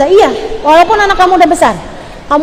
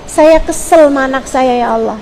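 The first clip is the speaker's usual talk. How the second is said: model prayer. Shown as a demonstration. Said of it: "kamu doanya kayak gini".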